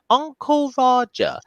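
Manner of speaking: put-on high and drawn out like a small alien child; high, slow kid voice. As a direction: stretch it slowly in a tiny high voice. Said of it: "like, like".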